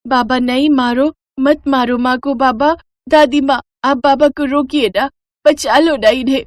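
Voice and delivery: put-on high, childlike begging; a small girl crying out for her mother; pleading little girl. Little girl begs father to stop.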